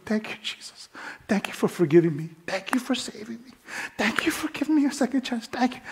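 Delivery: breathy, choked thanks, rising high on the words; tearful whispered prayer. Retold or imitated. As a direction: whisper it through tears, overwhelmed. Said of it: "And I just start crying".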